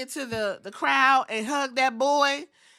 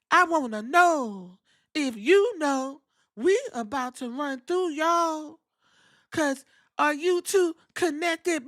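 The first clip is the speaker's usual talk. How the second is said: slower, higher put-on creepy wrestler voice, cracking at the end; mock Bo Dallas accent; 5 semitones higher than usual. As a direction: ham up a spooky wrestler promo. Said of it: "Bo Dallas and his accent".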